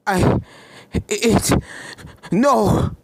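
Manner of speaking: broken stammer rising to a cry on no; frightened stammer. Acted. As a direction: stumble over the words, then blurt no in fear. Starlight cornered, backing away.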